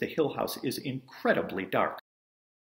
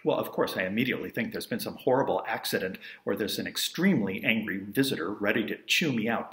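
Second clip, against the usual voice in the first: usual speaking voice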